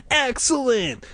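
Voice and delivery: excited. A narrator's reading. Casey delighted by booth.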